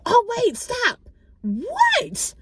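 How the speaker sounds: very high, loud frantic "stop"; mock frantic mother shrieking high; panicked shriek. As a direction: mimic a passenger losing it. Mom panicking again.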